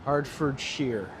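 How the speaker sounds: slow, deliberate Hertfordshire ending in "sheer"; careful Hertfordshire. Shown as a demonstration. Streamer practising the county name slowly to himself. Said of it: "It's pronounced sheer".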